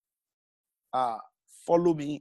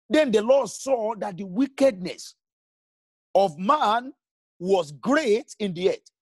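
raised, measured reading of the verse; scripture reading; 4 semitones higher than usual. Acted. Preacher reading Genesis to his congregation, measured scripture reading. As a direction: read the verse aloud to the congregation, deliberately.

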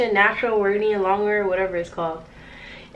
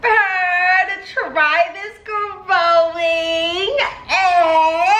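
excited squeal; 9 semitones higher, much slower than usual. Real, not acted. Excited channel milestone cheer.

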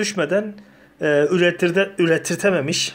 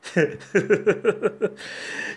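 breathy laugh; 4 semitones higher than usual. Real, not acted. Laughs at Ubisoft bug joke. (sessiz gülüş)

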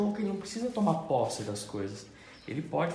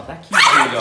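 loud demand; 3 semitones lower, 17 dB louder, slower than usual. Shown as a demonstration. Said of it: "Você falou".

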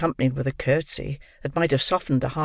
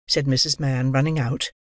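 ordinary delivery, nothing marked

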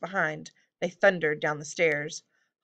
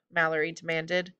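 plain reading voice

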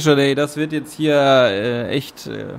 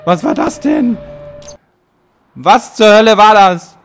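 startled loud shriek of shock; scared horror game streamer; 8 semitones higher, 8 dB louder, much slower than usual. Real, not acted. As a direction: jump out of your seat in fright. Sudden shock at an enemy.

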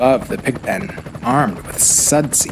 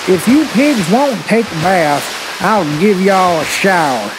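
slightly raised, playful threat; farmer's threat; 4 semitones higher than usual. Acted. Farmer with a hose warns the pigs, mock threatening. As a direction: warn them like a fed-up storybook farmer.

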